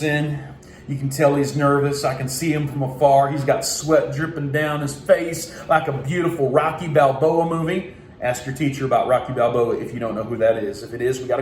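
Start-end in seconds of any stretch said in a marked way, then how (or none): none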